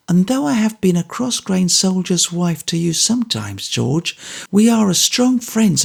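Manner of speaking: high, sincere soldier's wife; Mrs Bagnet, sincere. A narrator's reading. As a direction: tell an old friend she means it. Mrs Bagnet, heartfelt speech.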